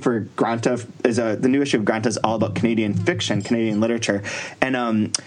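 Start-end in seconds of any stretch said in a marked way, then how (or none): none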